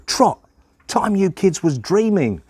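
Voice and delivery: high, called-out command; brisk order. Acted. Pa packing the children off, brisk high call. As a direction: send the kids off briskly. Pa sends guessing children away.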